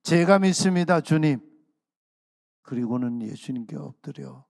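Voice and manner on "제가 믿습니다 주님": loud, higher declaration of faith; fervent confession. Acted. Healed man confessing faith to Jesus, loud fervent declaration. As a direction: proclaim it out with full conviction. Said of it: "고백합니다".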